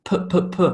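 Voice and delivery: P popped from the lips; three separate little P bursts; lip consonant demo. Shown as a demonstration. Teacher showing lips make the sound, popped P demo. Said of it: "distinctly using my lips".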